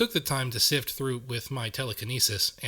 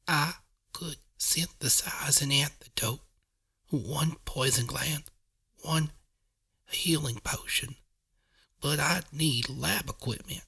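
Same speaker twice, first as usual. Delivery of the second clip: slow, breathy near-whisper; softly, labored breath; 3 semitones higher, slower than usual. Acted. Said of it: "Morning Glory said softly".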